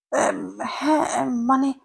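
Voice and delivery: halting, stumbling over fillers; flustered. A narrator's reading. Old teacher, taken aback at being recognised, falters flustered. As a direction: caught off guard, hunting for words. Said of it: "মহিলা থতমত খেয়ে বললেন".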